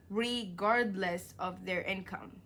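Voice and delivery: regardless said slowly and deliberately; careful reread after stumbling; slow careful reading. Shown as a demonstration. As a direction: finally get regardless right, slowly. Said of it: "reg-, bruh".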